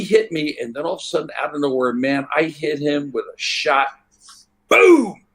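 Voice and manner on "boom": big punch sound effect; loud boom. Retold or imitated. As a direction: boom shouted much higher and louder. Punch landing in retold fight.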